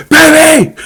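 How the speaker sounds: high, loud mock call to a baby; yelling to wake a baby. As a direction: shout the baby awake, laughing. Retells Kenna waking the baby doll.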